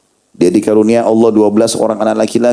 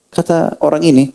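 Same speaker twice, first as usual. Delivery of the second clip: usual speaking voice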